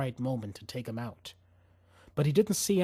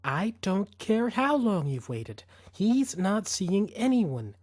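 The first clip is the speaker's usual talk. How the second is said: slow, higher, each word bitten off cold; cold and staccato; 5 semitones higher, slower than usual. A narrator's reading. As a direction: shut him down word by word. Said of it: "Her voice went cold and staccato".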